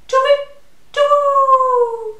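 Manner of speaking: high loud hoot of alarm; owl in panic. Acted. Frightened owl fleeing the mouse, loud high shriek.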